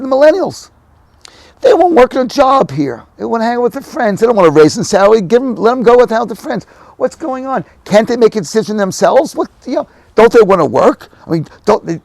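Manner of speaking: high, loud acted rant; exasperated complaint. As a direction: replay an indignant boomer's griping at full pitch. Re-enacts own complaint about millennials.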